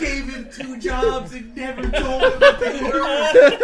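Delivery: slow, high laughing recap. Real, not acted. Laughing about the forgotten jobs.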